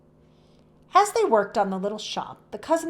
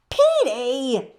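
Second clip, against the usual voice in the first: louder scolding call of the name; 5 semitones higher, 8 dB louder than usual. Acted. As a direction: tell the naughty bird off, loud. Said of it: "scolded the tiny woman".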